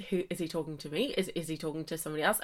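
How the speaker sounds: low, hushed, rapid self-questioning; quick, quiet panicked thoughts; flustered aside. Retold or imitated. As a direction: mutter it fast, as if thinking aloud. Panicked private thoughts at his greeting.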